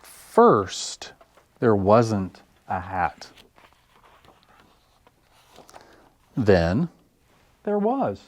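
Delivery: lively picture-book read-aloud with big pauses; expressive children's story reading. Acted. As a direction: read it like story time for little ones.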